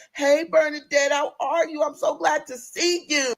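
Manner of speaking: high, slow sugary greeting; sweet on the surface, forced; fake polite. New server greeting Bernadette, fake sugary politeness.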